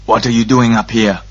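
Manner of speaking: harsh guard's challenge; rough, forceful questioning; harsh demand. A narrator's reading. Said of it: "a harsh voice demanded".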